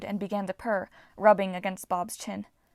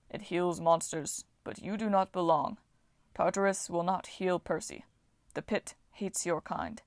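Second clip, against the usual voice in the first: Titan voice; 4 dB quieter than usual. A narrator's reading. Voices Bob.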